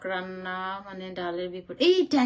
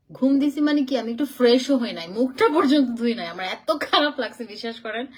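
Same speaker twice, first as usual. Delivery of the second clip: louder, higher exasperated rush; worked-up complaint; 3 semitones higher, 8 dB louder than usual. Real, not acted. Telling viewers she did not even wash her face, in a loud exasperated voice. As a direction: vent it to the viewers, voice up. Complaining about tiring journey.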